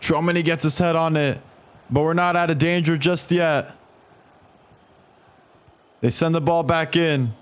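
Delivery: ordinary talk, nothing marked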